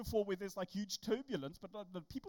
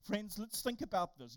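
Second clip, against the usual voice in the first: ordinary delivery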